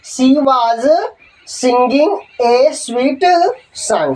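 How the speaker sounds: very slow, drawn out word by word; slow dictation. Shown as a demonstration. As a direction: spell it out slowly for the notebook. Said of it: "इसकी अंग्रेजी क्या बनेगी दोस्तों". (धीमे-धीमे बोलकर लिखवाना)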